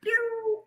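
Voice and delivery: very high, soft squealed sound effect; high-pitched pew. Retold or imitated. Reacting to scenes with [name], high-pitched pew. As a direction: let out a tiny high pew.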